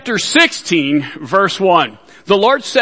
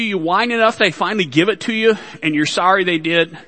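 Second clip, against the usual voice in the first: normal talking voice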